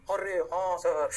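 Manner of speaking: slow, drawn-out student reply; student chorus. Acted. Teacher voices the class agreeing, slow student chorus. Acts the class answering him.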